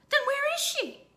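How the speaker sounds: high, loud panicked question; mother panicking over missing baby. Acted.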